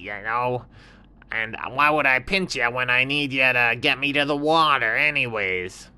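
high, sly little scorpion; high little scorpion voice, offering help. Acted. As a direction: squeak it out like a small sly critter.

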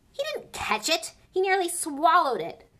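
loud furious protest; angry howl. Acted. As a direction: howl it at the referee. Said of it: "Flint was still howling".

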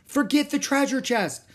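very high, loud call; urgent insisting. Acted. Girl to dawdling brother in storm, urgent calling. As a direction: call out impatiently.

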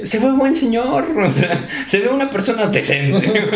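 high, drawn-out placating plea; acted appeasing excuse. Son excusing the other driver to his mother, mock placating. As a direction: plead the case lightly, through laughter.

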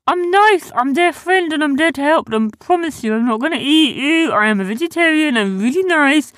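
high, loud creature babble; high-pitched desperate reassurance; pleading Sniz. Acted. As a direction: frantically insist you are harmless.

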